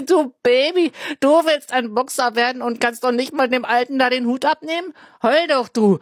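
loud, high jeering taunt; mocking taunt. Smallest boy taunting scared Elias, loud high jeering. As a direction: sneer and yell at the crybaby. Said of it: "spottet der Kleinste von ihnen".

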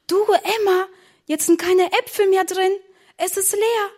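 put-on high-pitched childish dismay; alarmed discovery. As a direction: report it with mounting worry, pitch high. Discovers the strudel is empty. (erschrocken, mit hoher Stimme)

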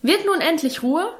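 high-pitched exasperated rebuke; irritated father demanding order; scolding. A narrator's reading. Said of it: "schalt Vater in erhöhter Lautstärke".

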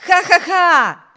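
slow, loud gloating laugh; triumphant laugh. A narrator's reading. Wibert gloating over Bruce missing out, triumphant laugh. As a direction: laugh out in glee at his win.

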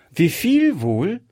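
put-on a curious little girl scolding gently; child Gretchen. A narrator's reading. Gretchen questions the old shopkeeper, high and childlike.

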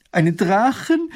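high, loud shocked gasp of a question; horrified cry. Acted. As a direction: cry it out in horror. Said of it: "schreit Mathilde entsetzt auf".

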